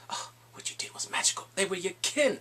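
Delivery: hushed mocking whisper; sly whisper. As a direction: whisper the jab as an aside. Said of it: "He's like".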